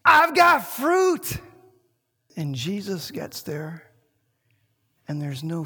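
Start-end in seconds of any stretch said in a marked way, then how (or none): 0.0-1.4 much higher, louder, slow showy call; boastful tree. Acted. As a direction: shout it like a tree showing off its leaves. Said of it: "It's saying".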